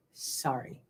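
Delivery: breathy whispered aside; hushed apology under the breath; whispered sorry. Real, not acted. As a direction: drop to a whisper for a quick sorry.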